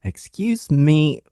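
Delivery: slow, stretched excuse me with a long u; drawn-out cute excuse me. Retold or imitated. Imitating the polite way people say it in passing. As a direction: drag out the u in "Excuse me" sweetly. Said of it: "I often hear that a lot".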